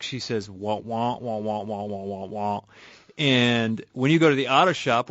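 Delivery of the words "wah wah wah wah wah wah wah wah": muffled wah-wah trombone mimicry; Charlie Brown teacher voice. Host imitating the Charlie Brown teacher's wah-wah for listeners. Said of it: "in the teacher talk".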